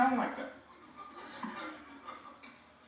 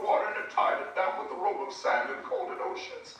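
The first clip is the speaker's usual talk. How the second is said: much higher, hammed-up grand orator voice, half laughing; R G Lee impression; 12 semitones higher than usual. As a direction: soar up into an old-time pulpit orator, savoring every image, a laugh under the words. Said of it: "R G Lee would say".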